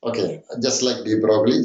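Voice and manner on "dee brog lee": de Broglie said as "dee BROG-lee"; de Broglie read as spelled, ending in "-glee"; mispronounced name. Shown as a demonstration. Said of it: "it is not correct pronunciation".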